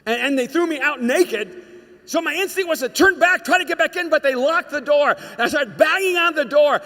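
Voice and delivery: loud, high, urgent retelling; worked-up storytelling. Retold or imitated. Preacher reliving being thrown out naked and locked out, worked-up. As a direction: raise the voice as the panic mounts.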